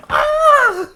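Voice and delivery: high loud laughing shout; laughing yell. Acted. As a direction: let a wild laughing yell out.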